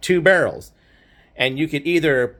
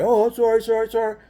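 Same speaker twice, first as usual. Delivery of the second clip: mock apology; 7 semitones higher, faster than usual. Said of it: "now he's all sorry".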